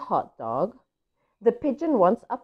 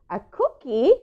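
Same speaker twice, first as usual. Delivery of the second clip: plain reading voice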